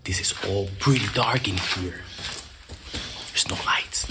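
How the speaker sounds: soft hushed whisper. Real, not acted. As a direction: whisper it in the dark. Exploring dark haunted rooms.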